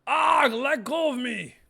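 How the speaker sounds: high, loud, slow cry to be let go; struggling character. Acted. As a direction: pull away and yell.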